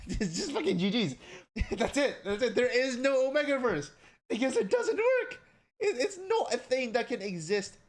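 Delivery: high, loud, incredulous outburst; worked up and loud, half laughing; exasperated rant. Real, not acted. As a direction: let the rant boil over into a high yell.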